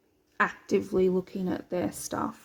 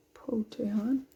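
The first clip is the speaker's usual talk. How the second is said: whisper; 5 dB quieter than usual. Real, not acted. Muttered at thread.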